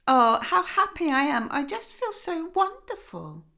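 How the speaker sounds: the father beaming with delight; lightly voiced joyful father.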